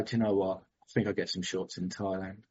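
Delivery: soft, low, settled inner voice; hushed, at peace with it; quiet resolve. Retold or imitated. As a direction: let it go quietly, a calm shrug. Calm decision after letting go.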